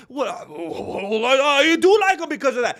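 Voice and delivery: mock fan laughing through it, loud; caving in with a laugh. Fan caught out, laughing as he caves.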